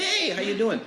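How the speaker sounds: high-pitched imitation of a friendly mom; cheery greeting. Mom greeting a regular customer, imitated cheerily.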